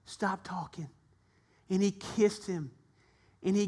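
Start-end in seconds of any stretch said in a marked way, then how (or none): 0.0-0.9 much softer, tender hush; father stops his son's apology softly; gentle father. Acted. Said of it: "He said".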